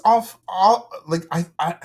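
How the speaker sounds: tripping over repeated words; stammer, restarting as the words won't come; real stuttering. Real, not acted. Podcast guest amazed by the story, stammering. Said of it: "I'm stuttering because I'm just like".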